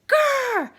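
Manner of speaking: loud high mock bear growl. The bears growling at Goldilocks in bed, loud mock growl. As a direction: growl to scare her off.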